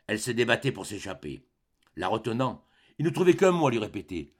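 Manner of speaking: plain reading voice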